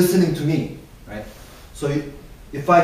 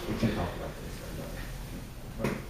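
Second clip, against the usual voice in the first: whispered, much softer and slow, hard to make out; mumbling demo; 10 semitones lower, 15 dB quieter, slower than usual. Shown as a demonstration. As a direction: mumble it under your breath so nobody understands.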